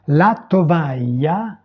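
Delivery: "La tovaglia" slowly and distinctly in Italian; Italian pronunciation demo. Shown as a demonstration. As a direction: model "La tovaglia" slowly for learners. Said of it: "prononcer les mots". (prononcer « La tovaglia » lentement, à l'italienne)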